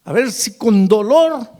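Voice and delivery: imitated desperate plea, slow, swelling high and loud; mock weeping plea without real sobs; retold tearful plea. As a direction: plead slowly as the weeping man, building to a loud peak. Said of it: "llorando ese pobre hombre me dice".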